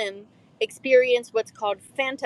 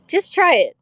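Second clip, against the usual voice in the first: higher, louder insistent urging; insistent dad; 8 semitones higher, 6 dB louder than usual. Acted. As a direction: push the kid to try it, pitched high. Dad urges child in story.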